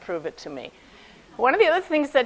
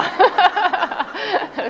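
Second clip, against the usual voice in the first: laughing; 9 semitones higher, 6 dB louder than usual. Real, not acted. Laughs after teacher quote.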